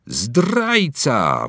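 loud accusing shout; child's yell. Acted. Boy accusing Winston, yelling at him. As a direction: yell it at the traitor. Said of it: "wrzeszczał dzieciak".

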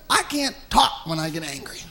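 ordinary talk

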